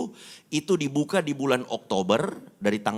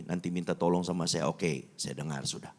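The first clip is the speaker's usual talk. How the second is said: ordinary delivery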